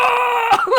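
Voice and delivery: high shrieking laugh of disbelief. Retold or imitated. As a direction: burst out in a squealing laugh. Shocked outburst at ruined recording.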